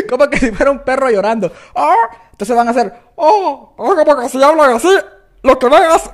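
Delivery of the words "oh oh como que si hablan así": high, swallowed howling speech; strangled high voice, half howl; crying-dog voice. Shown as a demonstration. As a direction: talk through a dog's whimper. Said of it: "para hablar así".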